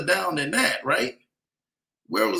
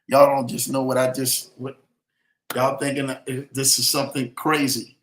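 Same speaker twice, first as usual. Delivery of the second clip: preaching; 4 semitones lower than usual. Acted. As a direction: preach it like a promise over the crowd. Livestream preaching to viewers.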